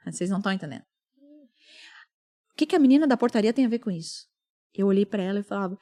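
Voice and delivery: ordinary talk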